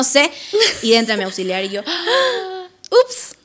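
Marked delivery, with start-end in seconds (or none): 1.9-2.6 sharp, laughing caught-out gasp; playful gasp of getting caught; startled gasp. Retold or imitated. Teacher walks in mid-fight. 2.9-3.4 mock caught-out oops. Caught by the school assistant.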